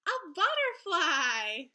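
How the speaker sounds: a slow, loud, delighted riddle answer; excited reveal. Acted. Teacher revealing the riddle answer to her class, excited and loud. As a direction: announce the answer with glee.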